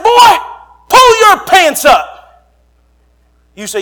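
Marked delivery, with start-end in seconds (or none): 0.0-2.5 high, loud, slow mock command to a boy; retold yell. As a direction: bark it at the kid like a father. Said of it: "I said".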